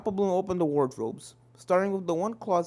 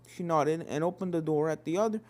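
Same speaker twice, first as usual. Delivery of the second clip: ordinary delivery, nothing marked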